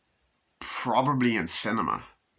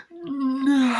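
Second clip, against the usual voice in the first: very high loud no; father seeing his son hit; anguished scream; 5 dB louder than usual. Retold or imitated. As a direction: cry out in horror. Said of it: "and Lewis just goes".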